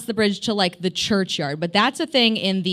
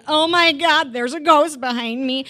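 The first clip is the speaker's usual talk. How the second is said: sudden fright, pitched up and louder; panicked; 6 semitones higher, 4 dB louder than usual. Retold or imitated. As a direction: blurt it out in a panic. Panicked retold quote.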